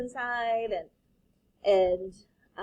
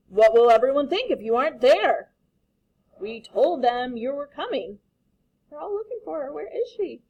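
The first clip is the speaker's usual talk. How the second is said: worried classmates; 4 semitones higher, 8 dB louder than usual. Acted. Imagined classmates' worry.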